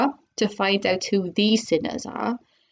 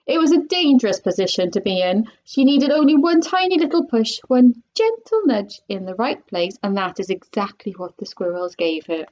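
plain reading voice

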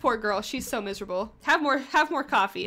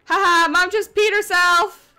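cackling with delight, high and loud; gleeful laugh; 6 semitones higher, 7 dB louder, slower than usual. Real, not acted. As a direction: laugh it out, thrilled and a bit evil. Gleeful reaction to game chaos.

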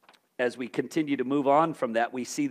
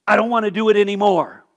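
fed-up outburst; 4 semitones higher, 9 dB louder than usual. Retold or imitated. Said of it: "He's just like".

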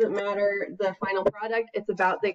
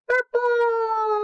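cheerful shout; 13 semitones higher than usual. Acted. Teaching baby the colour, high cheerful shout.

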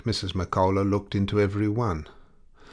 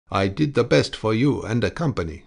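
plain reading voice

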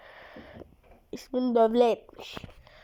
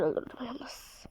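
whisper; 12 dB quieter, slower than usual. Real, not acted. Quiet gloat after a kill.

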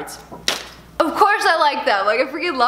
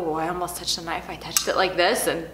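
startled hush; 8 semitones lower, 7 dB quieter than usual. Real, not acted. Startled near the knife.

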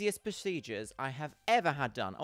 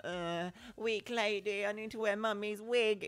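feeble weak lady; 6 semitones higher than usual. Retold or imitated. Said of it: "I was like".

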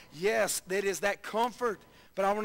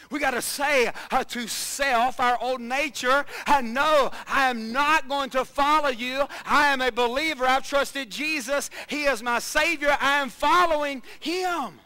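loud, higher preaching declaration; 4 semitones higher, 7 dB louder than usual. Acted. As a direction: declare it boldly to the congregation. Full-force preaching declaration.

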